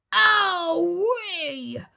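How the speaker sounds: high, loud yelp of pain; crocodile yelp of pain. A narrator's reading. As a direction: cry out as you tumble over. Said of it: "cried the enormous crocodile".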